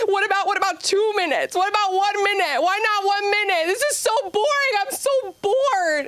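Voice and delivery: put-on very high, pleading child whine, slowing at the end; whining kids. Kids bargaining with their teacher over a break, very high whine. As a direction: beg like a bored little kid. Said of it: "they were screaming".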